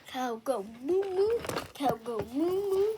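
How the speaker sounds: chanted cow noises, loud on the moos; playful mooing. Pretend.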